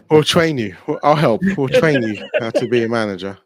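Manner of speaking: laughing while teasing. Real, not acted.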